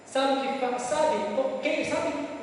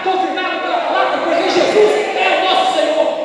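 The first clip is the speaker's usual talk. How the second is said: rising, ever louder preaching; full-force preaching; 4 semitones higher, 8 dB louder than usual. Acted. Pastor preaching to the congregation, building to a shout.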